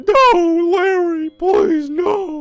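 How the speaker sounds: high, loud mock-crying begging; hammed-up tearful pleading; sobbing plea. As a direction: wail his name through fake tears. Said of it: "But she's going".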